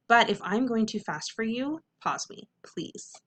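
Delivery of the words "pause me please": ordinary delivery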